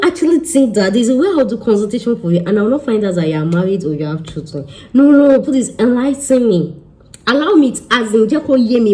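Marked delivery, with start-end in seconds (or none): 4.9-8.9 sarcastic mock plea. Mocking clients who hide marriage.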